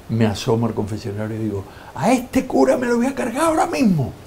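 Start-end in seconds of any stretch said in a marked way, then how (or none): 2.0-4.1 much higher, put-on disguised voice; mock threat. Pranking his old friend the priest, in a disguised high voice. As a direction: scare the priest in a fake voice.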